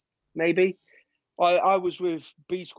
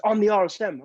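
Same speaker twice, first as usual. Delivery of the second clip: higher, louder "I'm the R S M" with rank behind it; barking sergeant major; 6 semitones higher, 5 dB louder than usual. Retold or imitated. RSM asserting rank, retold.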